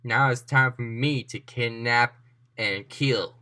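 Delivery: slow, low villain menace, sinking into a whisper; gloating villain. Acted. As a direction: threaten slowly, dropping to a whisper. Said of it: "Gracia is starting to laugh, and she said".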